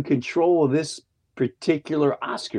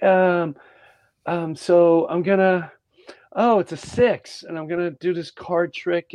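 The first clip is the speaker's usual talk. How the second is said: higher put-on voice, hesitant; mimicked video host; 6 semitones higher than usual. Host retells a woman's card tricks, higher mimicking voice. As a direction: imitate someone fumbling through a trick, pitched up.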